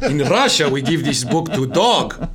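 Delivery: put-on thick Russian accent, slow and ominous; heavy mock Russian accent; heavy russian accent menace. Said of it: "that was a German accent".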